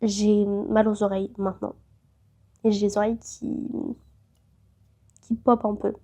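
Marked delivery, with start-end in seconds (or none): 0.0-1.7 sick voice. Real, not acted. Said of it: "Je vous avoue".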